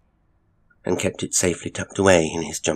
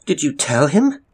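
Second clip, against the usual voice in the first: sharp, raised accusing question; bitter, loud accusation; 10 semitones higher, 6 dB louder than usual. A narrator's reading. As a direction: demand it bitterly, pitched high. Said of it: "Eleanor asked Elizabeth bitterly".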